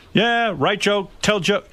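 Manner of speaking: slow mock-simple Jay; mock Jay caveman talk. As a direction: dumb it down like a simple joke machine. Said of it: "he goes".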